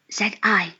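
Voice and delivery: usual speaking voice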